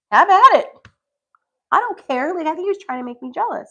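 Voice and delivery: dismissive. Retold or imitated. Brushing off the girl.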